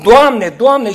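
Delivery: calls Lord, Lord out loud and high; a loud public plea. Retold or imitated. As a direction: cry it out for all to hear. Everyone cries Lord, Lord.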